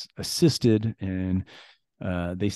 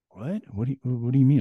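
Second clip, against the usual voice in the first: confused surprise; 5 semitones higher than usual. Retold or imitated. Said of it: "And he's like".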